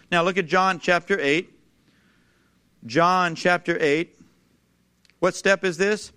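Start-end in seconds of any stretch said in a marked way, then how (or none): none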